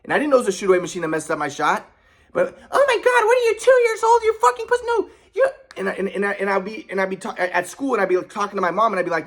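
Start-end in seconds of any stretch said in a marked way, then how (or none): 2.7-5.5 very high-pitched, contemptuous mom impersonation; scornful mom. Retold or imitated. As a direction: mimic a mother belittling her son. Acting out mother mocking his plea.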